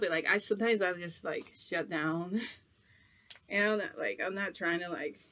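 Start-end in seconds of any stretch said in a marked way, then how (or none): none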